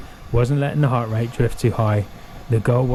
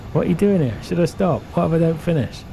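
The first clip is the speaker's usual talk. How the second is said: higher, worried self-doubting questions; anxious inner voice; 6 semitones higher than usual. Retold or imitated. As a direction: voice the nagging doubts. Anxious thoughts voiced, last miles.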